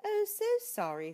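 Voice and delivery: very high, dainty mouse voice; tiny mouse asking for tea; polite little mouse. Acted. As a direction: squeak it out politely. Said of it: "said the mouse".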